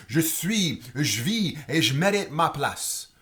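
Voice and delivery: loud, high, audacious declamation; bold cry. Acted. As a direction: cry it out boldly as the poem's climax. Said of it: "avec audace qui crie".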